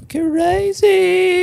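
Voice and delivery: squeaky-high, slow comic amazement; exaggerated disbelief. Real, not acted. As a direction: stretch it out, very high. Comic drawn-out reaction to absurd story.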